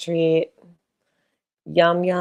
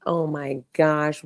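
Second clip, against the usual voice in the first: usual speaking voice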